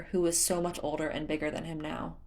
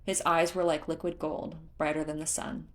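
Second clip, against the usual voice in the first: usual speaking voice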